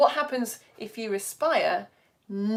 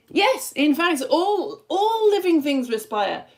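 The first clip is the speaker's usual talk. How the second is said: high, loud, slowed reveal with a laugh; excited reveal; 6 semitones higher, 6 dB louder, slower than usual. Real, not acted. Teacher revealing the answer to the class, excited. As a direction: announce it like amazing news.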